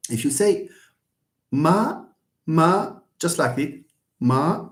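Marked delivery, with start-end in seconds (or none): 1.5-3.0 MA held high and level, no rise or fall. Shown as a demonstration. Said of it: "A high-level tone". 4.2-4.7 MA held even, neither rising nor falling; level tone. Shown as a demonstration. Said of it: "without rising or falling".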